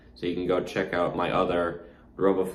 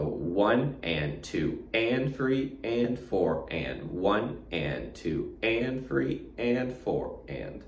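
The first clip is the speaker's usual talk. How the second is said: slow, evenly spaced teacher's count; measured, drawn-out rhythmic count; slow careful counting; 3 dB quieter, much slower than usual. Shown as a demonstration. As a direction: count out the beats slowly and steadily. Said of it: "pay attention to how I'm counting it".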